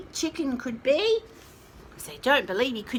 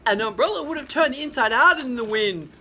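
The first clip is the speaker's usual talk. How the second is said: louder rooster voice, a hint of a growl; mild growl; 9 dB louder than usual. A narrator's reading. Rooster scoffs at the umbrella idea, mild growl. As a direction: grumble it like a cross rooster. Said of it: "growled the little rooster".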